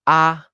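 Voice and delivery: "a" held high and even; high level tone. Shown as a demonstration. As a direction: sing out "a" on one high, unbending pitch. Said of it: "A es muy alto de tu voz".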